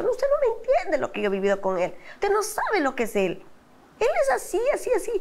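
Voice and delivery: exasperated protest climbing very high; retold arguing. Wife fighting with the pastor over husband, exasperation rising high. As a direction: argue back, pitch soaring by the end. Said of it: "Y yo peleaba con el pastor".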